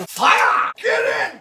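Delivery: high-pitched mock battle cry; over-the-top frantic command; frantic yell.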